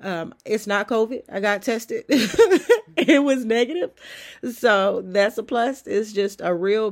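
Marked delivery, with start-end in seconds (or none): 2.1-2.9 a short high laugh bursting out; burst of laughter. Real, not acted. Vlogger laughing to viewers about her covid test, high laugh. 3.0-3.9 laughing. Real, not acted. Laughing about negative covid test.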